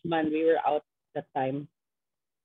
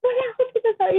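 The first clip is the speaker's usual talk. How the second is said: retold surprise; 5 dB louder, faster than usual. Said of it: "Sabi niya".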